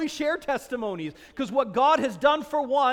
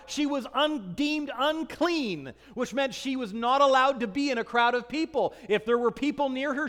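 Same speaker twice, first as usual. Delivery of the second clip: ordinary delivery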